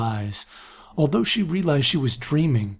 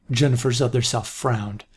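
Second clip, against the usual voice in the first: usual speaking voice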